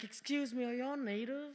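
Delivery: lightly voiced nosy shopper. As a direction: ask it sweetly as the curious lady. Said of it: "she walked up to me and she was like".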